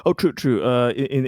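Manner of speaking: usual speaking voice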